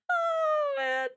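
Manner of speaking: ordinary talk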